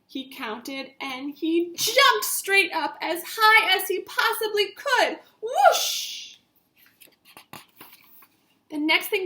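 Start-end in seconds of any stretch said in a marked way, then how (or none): 1.0-6.4 high, loud rising whoosh; excited build. A narrator's reading. Telling kids how Marty leapt, high and thrilled. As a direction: soar up with the jump. Narrating the big jump.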